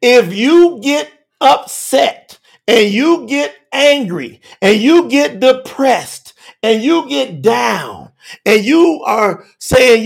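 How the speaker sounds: usual speaking voice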